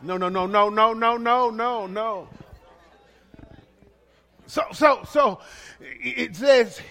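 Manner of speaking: ordinary talk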